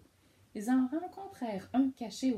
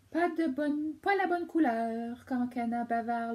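loud chatty duck; 4 semitones higher, 6 dB louder than usual. Acted. Duck answers loudly.

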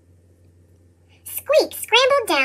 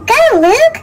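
called out; 7 semitones higher, 5 dB louder than usual. Acted. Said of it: "Skarloey cried".

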